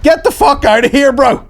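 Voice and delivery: shouting in disbelief at the chatty Airbnb host; exasperated yell. Retold or imitated. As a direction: blurt it out at full stretch.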